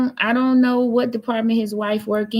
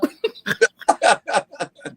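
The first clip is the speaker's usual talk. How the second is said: hearty laugh. Real, not acted. Streamer cracking up at their own mock threat, real laughter.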